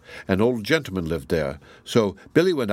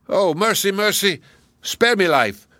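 high, louder pleading of a beaten giant; giant crying for mercy; 9 semitones higher, 4 dB louder than usual. Acted. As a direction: beg for your life, voice pitched up in panic. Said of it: "cried the giant".

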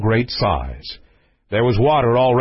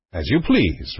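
low, unruffled prince; cool reply; 6 semitones lower than usual. Acted. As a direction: answer the raging king coolly, pitched down. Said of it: "returned the prince coolly".

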